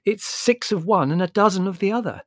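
triumphant. A narrator's reading. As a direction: sure you have won the point. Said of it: "said Margot triumphantly".

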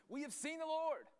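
high, loud mock proclamation; excited disciples. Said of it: "the other disciples were saying to him".

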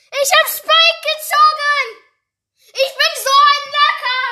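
high, loud yelling with pure joy; ecstatic screaming. Real, not acted. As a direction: scream it out, you finally won. Zieht endlich Spike, jubelt. (völlig ekstatisches Schreien)

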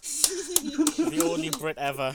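high, drawn-out words through laughter; helpless laughing delivery. Real, not acted. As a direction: repeat it while cracking up. Repeats own quip through laughter.

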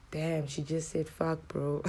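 slow, hushed mock disbelief; hushed aside. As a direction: act a stunned onlooker under your breath. Imitates shocked onlooker remarking aside.

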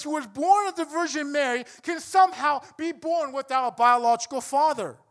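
high, forceful sermon delivery; impassioned preaching. Real, not acted. Preacher challenging brothers and sisters in Christ, impassioned preaching. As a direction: drive the argument home to the congregation with force.

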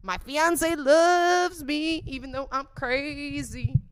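high, loud, slow mock Trisha voice; imitating Trisha. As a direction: coo it dreamily like a mock Trisha. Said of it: "she's just like".

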